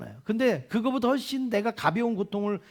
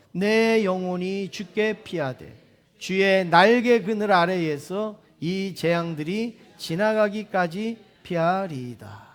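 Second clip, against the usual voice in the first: slow scripture reading; 2 semitones lower, slower than usual. Acted. Reading the Psalm together.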